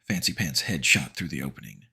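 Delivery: ordinary delivery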